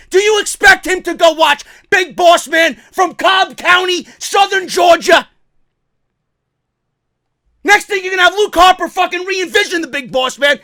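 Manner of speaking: high-pitched, scornful disbelief; incredulous ranting. Real, not acted. Fan ridiculing the Big Boss Man idea, incredulous ranting. Mocking the promoter's demand.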